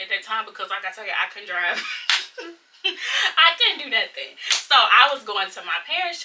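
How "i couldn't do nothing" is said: laughing through the words, higher and slower. Real, not acted. Laughing at herself while telling friends she couldn't drive. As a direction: chuckle it out.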